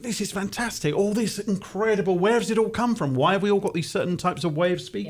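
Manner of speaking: high-pitched, marvelling inner voice; delighted wonder. Acted. Briton voicing his thoughts on accents, delighted wonder. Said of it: "you just think".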